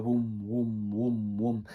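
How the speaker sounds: low, rhythmic imitated pulsing "woom"; pulsating woom sound. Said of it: "like, pulsating".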